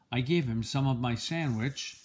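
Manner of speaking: plain reading voice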